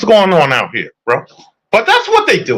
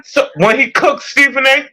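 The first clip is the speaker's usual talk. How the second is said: usual speaking voice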